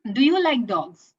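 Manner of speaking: ordinary delivery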